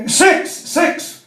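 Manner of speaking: put-on quavering old man. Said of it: "And the old fellow went".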